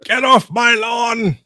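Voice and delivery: high, drawn-out crotchety shout; mock cranky yell. Teasing friend as grouch yelling at neighbors, mock cranky yell. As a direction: holler it slowly like a grumpy neighbor. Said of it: "he yells at neighbors for having fun".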